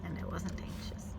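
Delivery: whispered aside; quiet whispered confession. Real, not acted. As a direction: hush it to yourself. Unvoiced aside.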